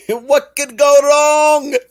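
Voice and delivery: high, loud mock-Irish cry; hammed-up Irish. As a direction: draw it out as a high, exuberant Irish cheer. Hams up a festive Irish cry.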